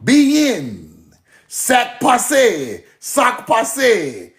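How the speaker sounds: mock Louisiana Creole. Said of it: "We wasn't saying that shit".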